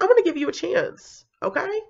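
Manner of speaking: high, coaxing principal; principal's offer. Retold or imitated. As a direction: lightly play the kind boss. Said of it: "she was like".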